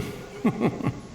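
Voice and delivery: put-on wry old man's chuckle. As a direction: chuckle at the irony, half resigned. Old man laughs at irony.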